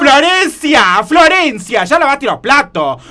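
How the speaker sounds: aguda, estirando el nombre; llamá a tu sobrina desde lejos; llamado a gritos. Acted. (gritando fuerte para que venga)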